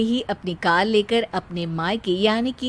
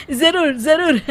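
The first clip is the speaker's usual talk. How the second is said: laughing eager yes; 6 semitones higher, 4 dB louder than usual. Acted. [name] eagerly agrees to recite.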